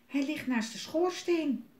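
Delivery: put-on high child voice; little boy. A narrator's reading. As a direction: pipe up high like a small boy. Frank explains where kite is. (hoog jongensstemmetje)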